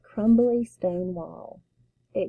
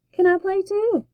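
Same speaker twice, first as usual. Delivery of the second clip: high, louder hopeful ask; little sister pleading to play; eager plea; 10 semitones higher, 5 dB louder than usual. Acted. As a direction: beg to join in, up high.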